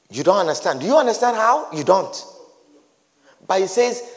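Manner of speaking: ordinary talk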